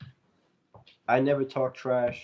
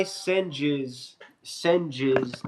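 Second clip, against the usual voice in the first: normal talking voice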